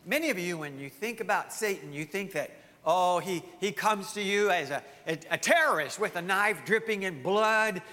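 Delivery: ordinary talk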